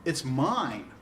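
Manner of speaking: "mine" swooping up, then falling; emphatic, stretched "mine"; stress on MINE. Shown as a demonstration. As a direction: lean hard into "mine" with a pitch swoop. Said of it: "there's some emphasis when it uses".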